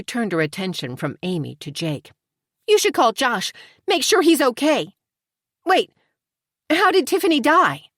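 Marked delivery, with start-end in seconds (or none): 2.7-7.9 higher, louder anxious urging; urgent worry. A narrator's reading. Tori urging Jake to call Josh, anxious and high. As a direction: Tori presses fast, panic rising.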